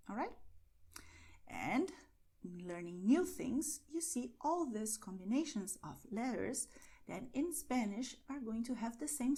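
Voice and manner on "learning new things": ordinary delivery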